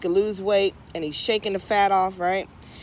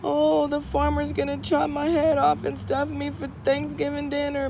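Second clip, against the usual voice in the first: higher, plaintive turkey voice; worried turkey lament, pitched up; whiny turkey; 6 semitones higher than usual. Acted. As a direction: whine it like a doomed bird. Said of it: "And divine masculine says".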